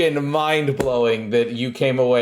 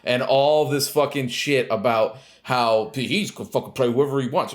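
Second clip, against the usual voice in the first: normal talking voice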